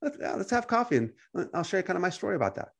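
soft, high, casual agreement; easygoing. Acted. Believer agreeing to chat over coffee, softly easygoing. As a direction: go along lightly and gently, pitch lifted.

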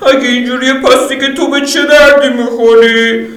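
high, laughing taunt; high female voice scoffing; Lydia, mocking. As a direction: tease him with a laugh. Lydia mocks him.

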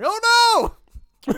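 very high, loud exclamation; mock horrified cry. Retelling realizing the potluck was missed, mock horror. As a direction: explode in hammed-up dismay. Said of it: "I'm like".

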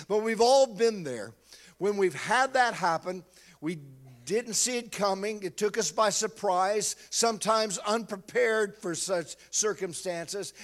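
normal talking voice

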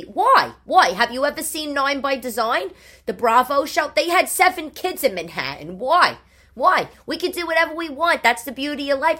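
loud, high, exasperated retort; incredulous protest. Retold or imitated. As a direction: argue back loudly, pitch jumping on each why. Retold argument with partner.

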